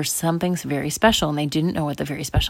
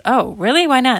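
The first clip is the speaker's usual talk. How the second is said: put-on higher, louder child's protest; 8 semitones higher, 7 dB louder than usual. Girl asks parents why not, protesting. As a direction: question the refusal loudly.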